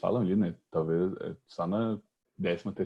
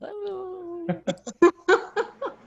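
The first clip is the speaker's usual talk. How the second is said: burst of high laughter; 10 dB louder than usual. Real, not acted. Joke about chipmunk voice.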